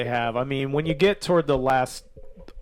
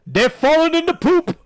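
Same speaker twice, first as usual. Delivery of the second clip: much higher, louder hyped-up narrator voice; VJ Emmy impression; 12 semitones higher, 7 dB louder than usual. Imitating the film's narrator for podcast friends, high and loud. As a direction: shout it like a gleeful movie narrator. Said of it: "V J Emmy is like".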